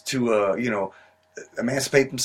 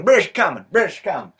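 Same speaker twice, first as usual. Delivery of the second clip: louder, higher cry while running off; crier shout; 5 semitones higher, 5 dB louder than usual. Acted. Revere reenactor dashing out the door, shouting. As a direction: yell the warning as you leave. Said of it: "it's Paul Revere".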